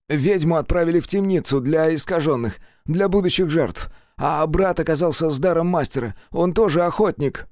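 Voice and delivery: rising pitch as he pleads; nervous prisoner. Acted. Jacob reports.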